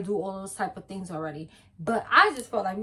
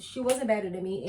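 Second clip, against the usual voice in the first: ordinary delivery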